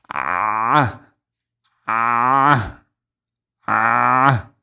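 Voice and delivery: ah pressed hard from a tightened throat; loud, strained pharyngeal ah; exaggerated throaty ah. Shown as a demonstration. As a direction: squeeze the ah out of the larynx. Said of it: "I have to exaggerate in the beginning".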